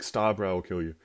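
ordinary talk, nothing marked

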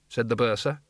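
ordinary delivery

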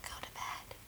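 spooky ghost whisper; demon whisper. Acted. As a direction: breathe it eerily like a ghost. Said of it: "my demon voice".

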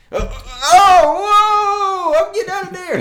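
very high, loud hammed-up alarm; mock panic. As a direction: yelp in exaggerated horror and flee. Acts out recoiling in alarm.

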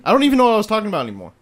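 high, loud, very fast outburst; exasperated. Real, not acted. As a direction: rattle it off high, fed up at being interrupted. Exasperated after being interrupted mid-story.

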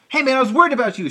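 high, loud imitated greeting; hammed-up concern for a pal; concerned French friend. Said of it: "Speaking of French, of course".

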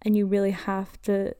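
normal talking voice

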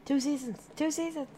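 high squeaky repeated chant. Pretend. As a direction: squeak it out as a joke.

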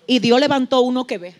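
usual speaking voice

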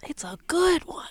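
hushed teaser whisper. Real, not acted. Whispering to her cohost about the story. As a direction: breathe it like a secret. Teasing a long good story.

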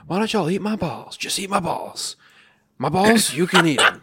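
put-on kid yelling. Kid yells at his classmates, loud taunting shout. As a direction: yell it like a rude schoolkid. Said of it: "He's just yelling out".

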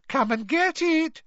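high, taunting mermaid voice; mock-sweet high taunt; malicious giggle. A narrator's reading. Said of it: "she giggled maliciously".

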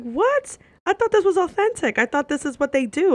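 high, incredulous retold protest; shocked disbelief. Told her beer order is wrong, incredulous. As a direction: blurt it out in high-pitched disbelief. Shocked reaction to bartender's remark.

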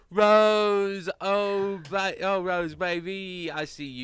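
Austin Flowers calling out. Acted. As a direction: catch her before she slips away. Austin Flowers spots Rose.

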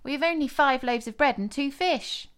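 exclaimed. A narrator's reading. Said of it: "they exclaimed".

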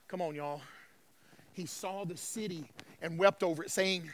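low, soft, grave scripture telling; solemn preaching. Real, not acted. Preacher tells congregation Jesus wept, solemn and soft. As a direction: bring the room down to a hushed, low register.

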